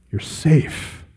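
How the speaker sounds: soft breathy whisper of comfort; whispered reassurance. Acted. Preacher assuring each believer they are safe, hushed whisper. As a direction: drop to a hush and reassure. Tender assurance after build.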